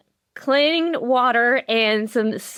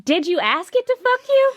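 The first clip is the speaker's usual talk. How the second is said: teasing high jab; 13 semitones higher than usual. Real, not acted.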